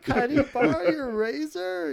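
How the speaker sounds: high, slow, lightly put-on voice of a makeup lady; mock makeup lady. Retelling makeup lady's question.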